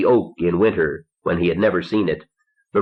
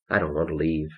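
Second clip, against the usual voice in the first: low-key, softly murmured line; quiet murmur, held close; 3 semitones lower, 7 dB quieter, faster than usual. A narrator's reading. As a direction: murmur it softly, barely projecting. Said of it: "she murmured".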